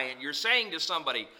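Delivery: ordinary talk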